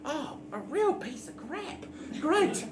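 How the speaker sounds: lightly voiced goblin, pleased over junk; goblin delight. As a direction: gush over the lint like a treasure. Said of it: "She goes".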